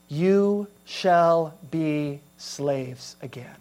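very slow, heavy, spaced words; grave verdict. Acted. Preacher voicing God's grim verdict on Israel. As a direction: let the sentence fall like a judgment.

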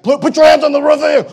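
quick, loud cop order; mock cop shout. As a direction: bark it like a cop. Cop barks at him.

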